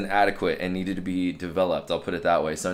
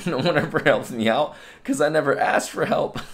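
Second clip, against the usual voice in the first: laughing it out, much higher and louder; laughing realization; 8 semitones higher, 4 dB louder than usual. Retold or imitated. Looking back on his younger self, laughing at the obvious answer. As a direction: chuckle through the punchline of your own lesson. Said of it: "I had the realization".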